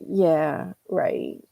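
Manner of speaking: low, drawn-out "Yeah... right", rising then falling; doubtful yeah right. Shown as a demonstration. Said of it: "Or they could say".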